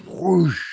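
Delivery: high, quick whoosh of a gust; imitated wind whoosh. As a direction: mimic a sudden gust of wind.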